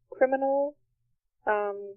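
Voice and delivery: ordinary talk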